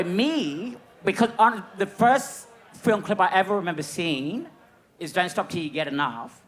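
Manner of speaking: usual speaking voice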